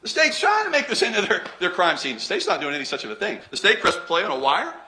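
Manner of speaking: mocking defense lawyer. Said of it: "Counsel in the opening statement".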